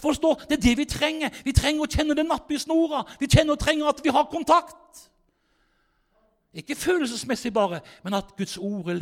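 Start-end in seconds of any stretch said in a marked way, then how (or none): 0.0-5.1 high, loud, fervent preaching; loud revival preacher exhorting the flock; preaching at full force. Acted. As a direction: drive it home to the congregation.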